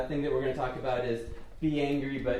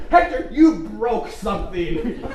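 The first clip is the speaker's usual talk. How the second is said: loud, high scolding shout; imitated holler, slowed for clarity; retold yelling at dog; 7 semitones higher, 9 dB louder than usual. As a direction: call out the dog's crime for the neighbors. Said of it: "I was yelling at the dog".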